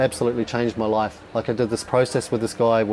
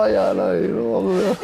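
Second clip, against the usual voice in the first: slow, laughing faux-modest agreement; smug proud reply; 6 semitones higher, much slower than usual. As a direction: drawl it with a proud chuckle. His smug reply to kids.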